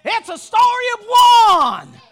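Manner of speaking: loud, high shouted preaching with held-out line endings; full-force preaching. Real, not acted. Preacher thrilled to be carried home, full-force shouted preaching. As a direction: preach it in driving phrases, stretching the last word.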